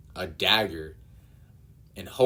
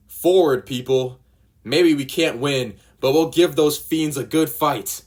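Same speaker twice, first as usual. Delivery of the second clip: much louder and higher, a battle cry; rallying shout; 7 semitones higher, 10 dB louder than usual. Acted. Genzo rallying the villagers against Arlong, battle cry. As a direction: rouse the villagers to charge.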